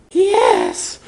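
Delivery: high, dazed groan of yes; lightly voiced drugged groan. A narrator's reading. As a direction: moan it in a drugged daze. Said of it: "Hermione groaned".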